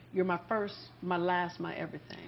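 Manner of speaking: low crooning romantic line. Shown as a demonstration. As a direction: croon it like a love song. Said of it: "I just have to have you say something for me".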